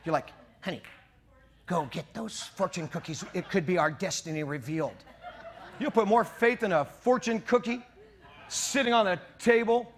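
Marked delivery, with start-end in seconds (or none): 0.6-5.0 softly acted aside to a spouse; hushed mock request. Diner asks honey to fetch the fortune cookies, hushed mock request. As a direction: drop the voice, as if sneakily asking.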